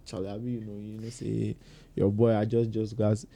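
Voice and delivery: low, very quiet appeal; smooth it over gently after a quarrel; subdued, hushed peace offering; soft making-up. Acted.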